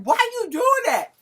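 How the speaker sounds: high, loud pleading scream; a high, loud screamed question; retold scream. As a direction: scream at the arguing people. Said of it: "I'll start screaming".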